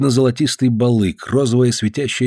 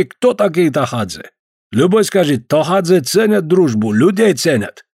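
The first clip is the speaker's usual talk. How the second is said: put-on Caucasian accent; 6 semitones higher than usual. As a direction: stretch each word with a heavy Caucasian accent. Georgian visitor voiced.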